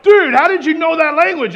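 high, loud mock astonishment; astonished. Amazed listeners to the tongues speaker, astonished. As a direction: blurt it like an amazed buddy.

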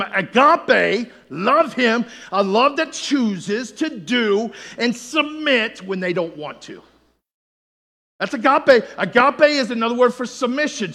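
loud, high preaching voice, building to shouts; fiery sermon delivery; full-force preaching. Acted. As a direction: preach it out to the congregation.

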